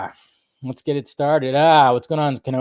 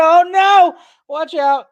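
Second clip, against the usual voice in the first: Oh no shot up high and loud, jokey panic; mock alarm; 14 semitones higher, 5 dB louder, slower than usual. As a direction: play-act being scared of the viewer. Viewer backs a rival, mock alarm.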